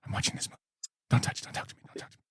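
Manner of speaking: imitating mom whispering, shushing; hushed whisper, eyes on the movie. Son imitating mom shushing dad while watching, whispering. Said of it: "She'll be like".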